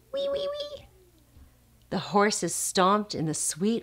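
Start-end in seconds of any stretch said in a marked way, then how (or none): 0.1-0.9 very high, soft squealing; pig squeal. Pretend. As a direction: squeal like a tiny piglet. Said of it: "the little pig squealed".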